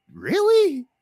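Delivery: high, incredulous really; mock disbelief. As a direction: squeak it out in disbelief. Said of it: "I'm like".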